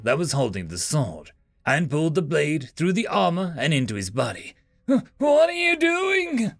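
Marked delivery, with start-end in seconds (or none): 4.8-6.5 croaking. Acted. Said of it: "croaked the Cabrasian".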